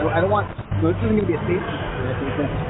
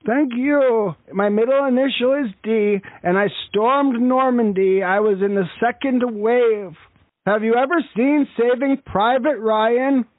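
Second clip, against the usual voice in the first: put-on higher-pitched elderly grandpa voice; old man voice; 5 semitones higher than usual. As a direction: play a proud old war veteran. Prank caller plays old veteran.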